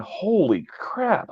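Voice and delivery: high, drawn-out astonishment; reenacted stunned reaction, slow and high; retold amazement. Acts out own amazement at recording.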